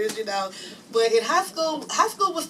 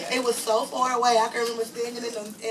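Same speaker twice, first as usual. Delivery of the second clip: usual speaking voice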